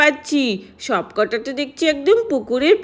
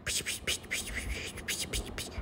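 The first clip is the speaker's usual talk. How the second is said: breathy secret whisper in the ear; conspiratorial whisper, unvoiced; 20 dB quieter, faster than usual. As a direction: whisper the plan into a friend's ear. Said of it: "কানে কানে শোন".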